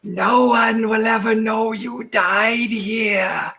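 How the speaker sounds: high, slow, hissing spectre; hissing ghost voice. Acted. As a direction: hiss it out like a drowned ghost. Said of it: "in this hissing voice".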